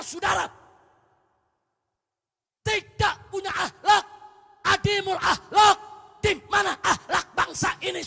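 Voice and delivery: high, loud preaching shout; khotbah berapi-api. Real, not acted. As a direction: thunder the challenge at the congregation. Preaches at full force. (khotbah lantang dengan nada tinggi)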